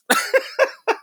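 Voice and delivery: airy high-pitched laugh; breathy high laugh. Real, not acted. Laughing after telling hypocrites to get out of her face.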